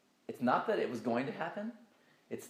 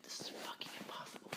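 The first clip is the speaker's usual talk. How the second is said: whispered in awe; awed whisper; 12 dB quieter than usual. Retold or imitated. Gazing at the texture in amazement, a whispered exclamation. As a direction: breathe it out in wonder.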